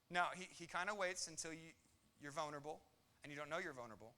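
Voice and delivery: ordinary delivery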